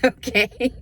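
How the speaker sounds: slow, laughing through it; laughing okay. Real, not acted. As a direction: let the laugh carry the okay. Joke about Cheerios prize.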